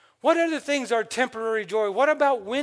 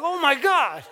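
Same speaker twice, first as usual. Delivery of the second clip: mock surprise; 5 semitones higher, 6 dB louder than usual. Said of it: "It's like".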